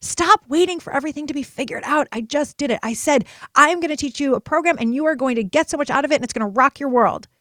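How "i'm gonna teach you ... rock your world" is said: louder, bright sales-pitch promise; excited promise. Retold or imitated. Promising women her new program, loud and excited. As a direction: sell it with big enthusiasm.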